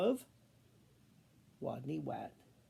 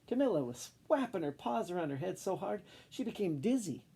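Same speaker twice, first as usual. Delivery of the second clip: plain reading voice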